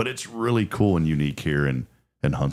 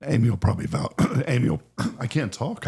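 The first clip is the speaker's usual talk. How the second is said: hoarse raspy throat; raspy voice, clearing the throat; 6 semitones higher than usual. Real, not acted. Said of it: "I have this really weird raspy voice".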